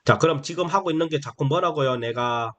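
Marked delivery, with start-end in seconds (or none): none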